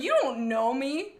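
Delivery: offended comeback. Retold or imitated. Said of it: "I would be so offended".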